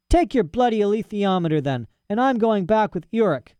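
put-on high young girl's voice, a touch of sulk; lightly voiced girl, huffy; defiant Lyra. A narrator's reading. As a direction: throw it back at him. Lyra storming off.

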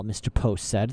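ordinary delivery

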